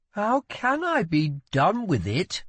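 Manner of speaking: high, slow, scornful question; prince's indignant scorn. A narrator's reading. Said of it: "scorned Rye".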